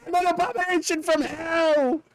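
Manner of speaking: high, mock-screeching abomination; hammed-up hellish monster voice; screaming monster impression. Gamer voicing an anime Pokemon that screams, high mock screech. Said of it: "He just goes".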